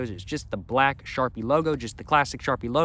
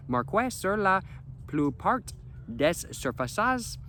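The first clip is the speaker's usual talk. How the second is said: higher-pitched, halting amateur French accent; 6 semitones higher, 4 dB quieter, much slower than usual. Shown as a demonstration. Vlogger reading marker packaging aloud, attempted French accent. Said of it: "enjoying my French accent".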